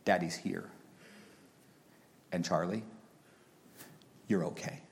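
soft, low fatherly reassurance; soft comfort to a frightened four-year-old; comforting his son. Retold or imitated. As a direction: hush a scared child on your lap. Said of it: "I got him on my lap and said".

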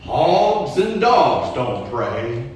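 put-on slow, drawled Texan accent; slow Texas drawl, loud and firm. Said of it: "in his great Texan accent, and I'll butcher it".

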